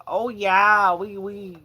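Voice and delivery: high, exaggerated Midwest accent; hammed-up Midwest "oh, yeah". Shown as a demonstration. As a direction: attempt an accent and land in Midwest. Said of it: "no, that's Midwest".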